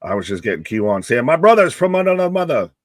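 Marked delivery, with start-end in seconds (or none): 1.2-2.7 loud catchphrase. Retold or imitated. Quoting the catchphrase.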